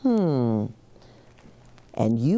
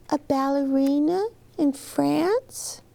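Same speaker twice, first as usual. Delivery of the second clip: lightly voiced child; 10 semitones higher than usual.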